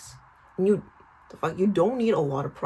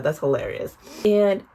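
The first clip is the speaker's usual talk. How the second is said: ordinary talk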